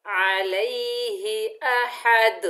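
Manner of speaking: slow, drawn-out chanted recitation; Quran recitation. Shown as a demonstration. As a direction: recite it slowly with tajweed. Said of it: "मिलाकर पढ़ते हैं".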